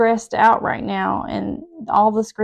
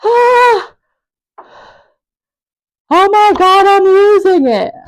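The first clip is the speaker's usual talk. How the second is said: high frantic shout of overwhelm; losing it; 12 semitones higher, 12 dB louder, slower than usual. Retold or imitated. Frazzled mom shouting at the car-line chaos. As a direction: scream it out, at wits' end. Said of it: "And I was just like".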